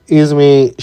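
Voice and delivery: usual speaking voice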